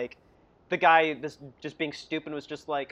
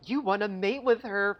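high, slow, odd mock-innocent voice; mock-innocent character; 6 semitones higher, 3 dB quieter, slower than usual. Imitating Dora asking Diego, slow and odd. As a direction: draw it out slowly like a strange innocent question. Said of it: "and she's like".

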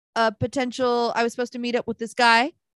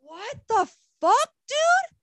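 mock baffled, voice sliding way up; high, incredulous retelling; retold bewilderment; 11 semitones higher, slower than usual. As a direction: pitch climbing high in disbelief. Retold reaction to his text.

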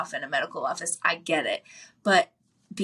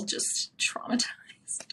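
whispered aside; 6 dB quieter, much slower than usual. Real, not acted. Trailing joke about nineties babies.

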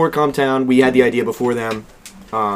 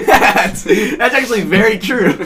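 slow words through real laughter, pitched up; laughing; 4 semitones higher, slower than usual. Real, not acted. Friend cracks up at the shirtless Jesus joke, laughing through it. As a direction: giggle out the agreement.

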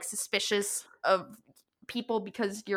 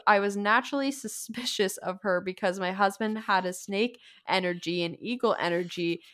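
normal talking voice

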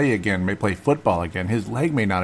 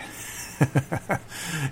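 high, soft hushed chuckle; a quiet embarrassed laugh; stifled laugh; 7 semitones higher, 4 dB quieter than usual. Real, not acted. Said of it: "if it sounds a little bit weird".

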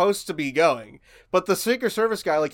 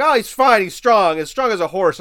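higher, louder mock confidence; dismissive reassurance; 4 semitones higher, 7 dB louder than usual. Friends insisting Biden is healthy, loud mock reassurance. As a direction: brush off the worry, overconfident. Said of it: "They're like".

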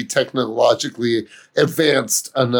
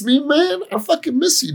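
high whiny mock plea; mock pleading; 12 semitones higher than usual. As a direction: beg him up high, joking.

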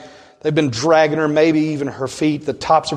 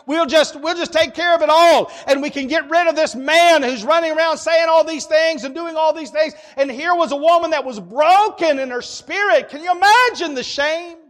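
high, driving preacher's voice, pushing fast; impassioned pulpit preaching; fiery preaching; 10 semitones higher than usual. Acted. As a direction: build the crowd's plot to fever pitch. Preaching at full force.

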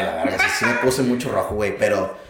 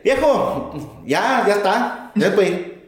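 put-on Oaxacan accent; 5 semitones higher, much slower than usual. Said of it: "Ay, los oaxaqueños".

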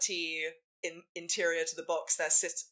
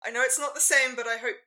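hopeful Ferris; 8 semitones higher, 7 dB louder, faster than usual. Retold or imitated. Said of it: "It's just like".

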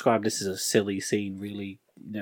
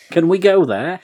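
mock eager; 9 semitones higher, 6 dB louder than usual. Playing Jenny, mock eager.

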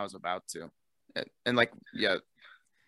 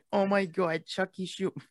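put-on old New Yorker accent; 10 semitones higher, 3 dB louder than usual. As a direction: push the old New York accent further. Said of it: "old New Yorker".

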